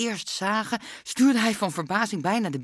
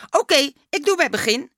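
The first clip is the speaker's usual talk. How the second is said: higher, louder impatient agreement; 8 semitones higher, 7 dB louder than usual. A narrator's reading. Dummy agreeing to start from the beginning, impatient and loud. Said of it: "met een ongeduldige zucht".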